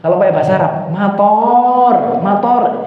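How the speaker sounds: udan said as mator in a mock Javanese lilt; mock Javanese Arabic. Teacher mocks how Javanese students say Arabic words. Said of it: "kalau orang Jawa harus fasih".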